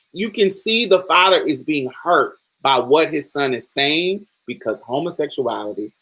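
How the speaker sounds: ordinary talk, nothing marked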